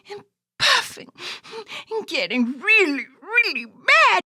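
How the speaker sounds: rising loud, huffy puppet; mock angry puppet. Puppet telling host it got mad, building loud. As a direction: build to a pretend tantrum.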